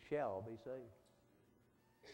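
very slow, solemn scripture quote; drawn out. Acted. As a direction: stretch shall be saved out slowly. Quoting scripture slowly.

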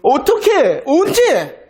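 high-pitched acted protest; protesting retort. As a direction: challenge God as a doubting people would. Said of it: "그들은 항변하며 대꾸했습니다".

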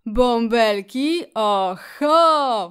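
loud, slow, drawn-out cry of recognition; joyful exclamation. A narrator's reading. Rat spots bubbles in the water, exclaiming joyfully. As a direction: burst out with glee. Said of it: "wykrzyknął szczur i pisnął radośnie".

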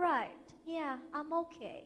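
slow, soft, unconvinced agreement. Acted. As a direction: drag it out quietly, only just okay. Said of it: "อันนี้ก็จะออกแนวแบบว่ามัน นะ งั้นๆน่ะ". (ตอบช้าๆ เบาๆ แบบงั้นๆ)

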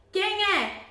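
a small goat asking through the door who is there; little goat asking. Acted. Kid asks who knocks.

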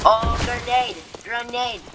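urgent yell of grenade; shouting warning. Real, not acted. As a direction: shout it out to warn the squad.

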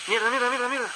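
frantic cursing. Real, not acted. Getting hit in the game.

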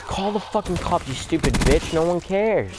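high-pitched retold taunt, without shouting; mock defiance. Kids daring the guy to call cops, mock defiant. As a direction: imitate kids jeering back, voice jumping up.

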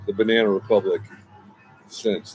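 ordinary talk

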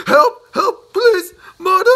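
very high, loud screams for help; screaming. Acted. Mister Jelly screaming for his mother under the falling leaf. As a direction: shriek for your mother. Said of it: "he screamed".

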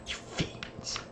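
barely voiced, hissed under the breath; a hushed, unvoiced You fiends; whispered mock curse. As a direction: whisper it like a defeated villain.

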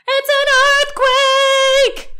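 high, loud shriek of alarm; panicked shout. A narrator's reading. Wife waking as the floor collapses, high panicked shout. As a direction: cry it out at the top of the voice. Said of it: "shouted Missus Watson".

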